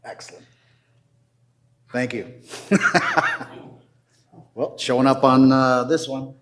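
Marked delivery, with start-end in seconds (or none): 2.4-3.7 short high-pitched laugh; a brief burst of high laughter. Real, not acted. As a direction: laugh it off after the thank-you. Laughs after getting screen help.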